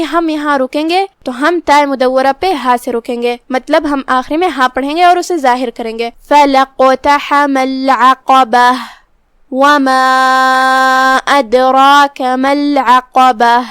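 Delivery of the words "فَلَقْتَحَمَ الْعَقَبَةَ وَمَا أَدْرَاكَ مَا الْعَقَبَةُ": slow, melodic tajweed recitation; Quran recitation. Shown as a demonstration. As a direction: chant the verse slowly as a Quran teacher. Teacher recites the verses. (قرآن کی تلاوت کا انداز)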